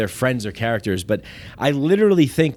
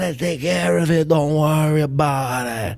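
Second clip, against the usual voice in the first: put-on Italian American accent; 2 semitones higher than usual. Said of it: "I said".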